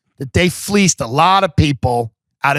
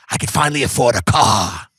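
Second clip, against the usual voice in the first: Ida; 5 semitones lower than usual. Acted. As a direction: lightly imitate an old sales veteran. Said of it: "That's how she talks".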